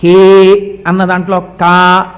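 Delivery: కా said slow and loud from the throat; throat sound ka. Shown as a demonstration. Teaching where కా is voiced, slow throat sound. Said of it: "కా పలకాలంటే ఎక్కడ పలకాలి".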